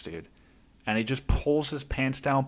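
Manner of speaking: ordinary delivery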